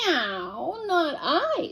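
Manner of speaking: high cat voice with a meow. Acted. Voicing the cat.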